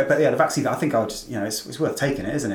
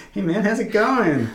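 high, drawn-out American greeting; hammed-up American accent, pitched well up; 11 semitones higher, 4 dB louder, slower than usual. Joking greeting to a friend, put-on American accent. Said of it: "sometimes put on an American accent".

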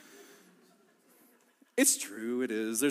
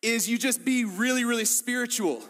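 mock pious; 7 semitones higher than usual. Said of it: "you might say".

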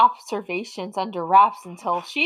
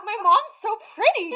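put-on innocent little boy; 10 semitones higher than usual. Imitating kindergartner, laughing.

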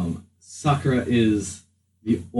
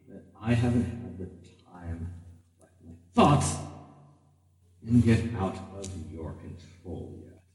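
sinister inner voice; 2 semitones lower than usual. Acted. GM voices the voice in the head.

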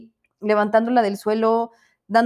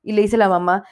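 normal talking voice

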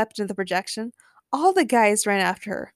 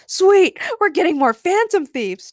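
high, loud whoop of delight; cheering; 10 semitones higher, 6 dB louder than usual. A narrator's reading. Skull-masked guy welcomes recruits, cheering. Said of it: "cheered a guy with a skull mask".

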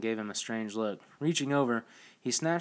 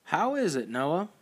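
higher, louder eager call; excited call; 6 semitones higher, 3 dB louder than usual. Acted. Sanchez excitedly calling to Noah in the pool. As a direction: call out across the spring, eager. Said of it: "Sanchez called excitedly".

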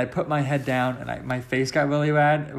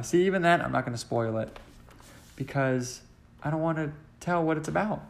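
ordinary delivery

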